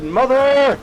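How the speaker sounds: high, loud cry of Mother; defiant shout. Retold or imitated. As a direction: yell it back from the waves.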